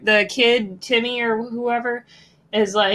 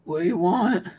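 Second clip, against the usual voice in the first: a lower, grumbling morning croak; grumpy morning voice; 4 semitones lower than usual. Retold or imitated. Host shows her morning voice to co-host, low and grumpy. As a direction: growl it like you just woke up. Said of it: "Mine's just like".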